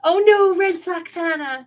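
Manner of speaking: loud high "oh no" of playful horror; mock dismay. Real, not acted. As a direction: groan out in fun, half-laughing. Viewer votes for rival team.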